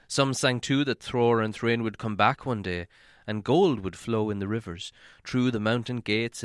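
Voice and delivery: usual speaking voice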